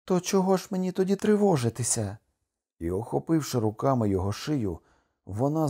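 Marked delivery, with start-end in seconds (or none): 0.0-2.2 high-pitched, relieved outcry; elated high-voiced question; joyful exclamation. A narrator's reading. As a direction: cry it out high, all worry gone. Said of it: "вигукнула Кокуа".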